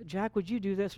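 a polite, gentle ask to a spouse; gentle request. Shown as a demonstration. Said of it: "when you use a tone to one another".

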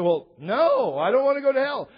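mock alarmed refusal, rising high and loud; lightly hammed-up obvious no; protesting kid.

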